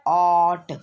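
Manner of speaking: ऑट loud, with a long drawn-out 'aw' before the t; phonics word ending. Shown as a demonstration. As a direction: blend ऑट into one stretched syllable, 'awwt'. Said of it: "ओ टी".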